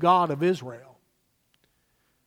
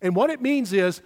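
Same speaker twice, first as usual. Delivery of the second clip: ordinary delivery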